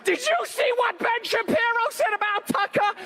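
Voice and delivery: mock excited gossip. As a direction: blurt the gossip out eagerly. Imitating everyone's gossip.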